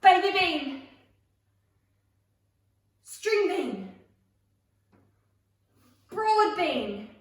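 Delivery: called out. Shown as a demonstration. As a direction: belt out each name, pitch falling. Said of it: "when I call a string bean".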